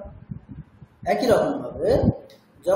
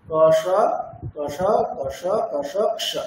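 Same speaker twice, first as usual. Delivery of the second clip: syllables faster each time; speeding up drill; 3 semitones lower, much slower than usual. Shown as a demonstration. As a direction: push the pace of the drill. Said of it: "এইরকম ভাবে অভ্যাস করবে".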